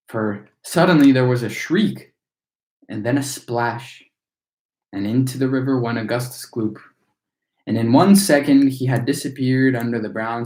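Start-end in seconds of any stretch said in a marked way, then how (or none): none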